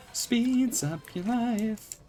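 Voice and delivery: high, slow, soft slogan; mock ad slogan. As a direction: sell it like a cheesy commercial. Repeats slogan higher.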